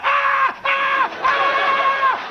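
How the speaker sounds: high mock fish shriek; hammed-up squealing fish cries; fish screaming. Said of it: "They'd be going".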